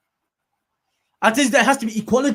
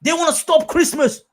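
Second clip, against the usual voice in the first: a hint of mock indignation, pitched up; mock outrage; 10 semitones higher, slower than usual. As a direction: lightly parody the outraged accuser, half laughing. Mocking people who blame Muslims.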